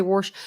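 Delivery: "warsh" with an R before the sh; regional "warsh" pronunciation. Shown as a demonstration. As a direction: say wash as "warsh". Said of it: "You say".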